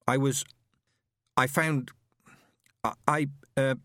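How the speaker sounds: halting, fading to a whisper; nervous stammer. A narrator's reading. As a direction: stumble over the words nervously. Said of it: "he blinked nervously".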